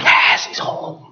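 drawn-out hushed cheer; excited whisper-shout. Retold or imitated. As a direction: whisper a retold yell of joy. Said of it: "I'm excited".

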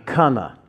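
Cana said as KAH-na; Cana, long "ah", not "ay"; local pronunciation. Shown as a demonstration. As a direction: say Cana with an open "ah" in the first syllable. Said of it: "as they pronounce it".

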